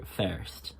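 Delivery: "first" with an "eh" vowel; "first" said with an open "eh"; Irish first. Shown as a demonstration. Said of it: "more of an eh sound".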